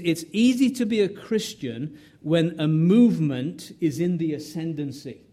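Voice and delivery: ordinary delivery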